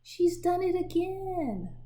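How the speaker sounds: high, loud, slow complaint; exasperated brother. Acted. Big brother Claude complaining about Libba, exasperated. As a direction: whine it to the room. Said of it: "Claude said".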